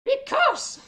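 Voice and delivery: very high, loud shrill creature shriek; squeaky angry voice. Acted. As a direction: shriek like a tiny furious hermit. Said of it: "squeaky, angry voice".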